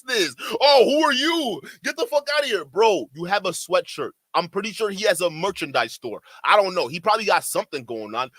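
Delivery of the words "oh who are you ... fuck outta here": mocking voice. Mocking someone's reaction.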